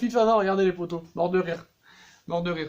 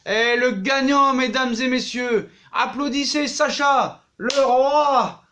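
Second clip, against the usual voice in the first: slow, high, louder showman announcing a winner; mock announcer; 6 semitones higher, 5 dB louder, slower than usual. Sacha crowns himself winner for his viewers, booming mock announcer. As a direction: ham it up like a ring announcer.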